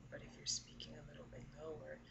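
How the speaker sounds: quiet, low, quick speech. Shown as a demonstration. Singer demonstrating the low voice, quiet low speech. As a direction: murmur it down low. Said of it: "that would be my low voice".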